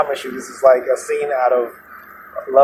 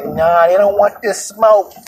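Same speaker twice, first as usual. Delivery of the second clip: high, slow mock boast; raised-pitch swaggering imitation; imitated tough woman; 6 semitones higher, 4 dB louder, slower than usual. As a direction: mimic her defiant drawn-out brag. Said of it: "But she said, just like".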